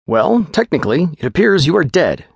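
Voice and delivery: quick, businesslike physician giving orders; lightly voiced clinical doctor; brisk doctor.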